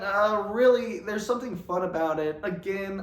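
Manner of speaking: ordinary delivery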